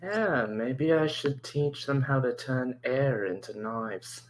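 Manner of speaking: usual speaking voice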